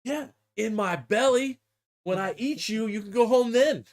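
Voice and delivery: imitated orc boss; menacing mech boss. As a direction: toy with the minion, then land the threat. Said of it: "the mech boss is like".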